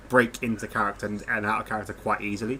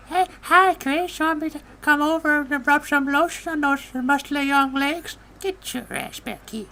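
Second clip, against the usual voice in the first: put-on much higher, wheezy old creep voice; Herbert impression; 4 dB louder, slower than usual. Doing a cartoon pervert's line for a friend, high and leering. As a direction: lech at him like a creepy old neighbor. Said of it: "Herbert the pervert from Family Guy".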